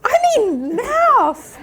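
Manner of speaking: high, loud exasperated fix; loud self-correction. Real, not acted. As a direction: blurt out the right word. Correcting slip, laughing.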